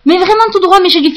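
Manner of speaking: usual speaking voice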